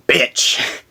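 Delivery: bitch, breathed with no voice; a breathy, voiceless bitch; whispered bitch. Real, not acted. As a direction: hiss the word bitch under your breath. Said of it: "Just plain bitch".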